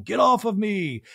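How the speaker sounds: a hammed-up shout while being thrown down; mock yell.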